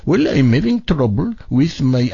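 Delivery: put-on call-center voice as a prank caller; mimicking the agent's voice. Host retelling his prank on tech support, mimicking the agent. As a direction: copy the agent's voice right back. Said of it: "I did the same voice he was doing back to him".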